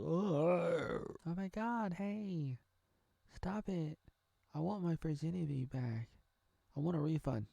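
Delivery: lightly acted high-pitched protest; whining girl. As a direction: whine like a wronged girl. Girl protesting, demanding a refund.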